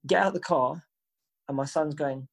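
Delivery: ordinary talk, nothing marked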